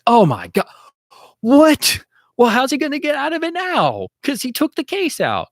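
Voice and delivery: very high, loud rising what, then high worried squeak; shocked fan. Retold or imitated. Book fan sees Grant take the case, high shocked squeal. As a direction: gasp as the cigarette case is pulled out. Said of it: "and you'd be like".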